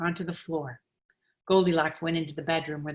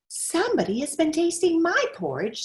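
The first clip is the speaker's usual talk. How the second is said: higher, lightly voiced mother bear; middle-sized bear voice; 11 semitones higher than usual. Middle-sized bear finding her porridge touched, higher and lightly voiced. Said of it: "in her middle-sized voice".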